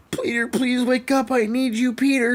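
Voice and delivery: hammed-up damsel begging; imitating M J pleading. Gamer retells M J pleading over Peter, mock high voice. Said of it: "She's like".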